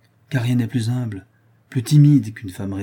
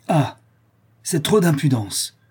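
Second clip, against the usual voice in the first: raised, haughty cry of disgust; indignant, disdainful exclamation; disgusted hauteur; 5 semitones higher, 4 dB louder than usual. A narrator's reading. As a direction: recoil in lofty outrage, louder and higher. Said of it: "s'écria la duchesse en se reculant avec tant de dégoût et de hauteur".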